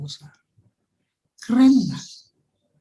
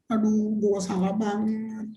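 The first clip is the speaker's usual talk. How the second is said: mock remorse; 8 dB quieter than usual. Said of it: "Terus kamu nangis".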